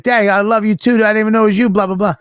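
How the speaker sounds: high, excited retold gushing; imitated star-struck babble; gushing reply.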